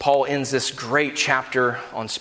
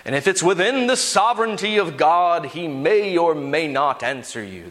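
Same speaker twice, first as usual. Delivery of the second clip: mock God voice. Preacher jokingly misquoting the verse on asking, mock God voice. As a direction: draw it out solemnly as a hammed-up God. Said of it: "That's my God voice, by the way".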